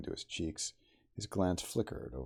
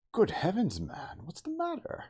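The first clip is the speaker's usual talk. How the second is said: sharp high alarmed cry; narrated cry; 5 dB louder than usual. A narrator's reading. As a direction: cry out in shock at the sight. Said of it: "cried the medical man".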